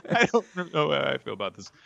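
laughing high through the words. Real, not acted. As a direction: giggle it out, unsure. Laughing, unsure about Kaguya.

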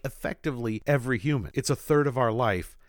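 ordinary talk

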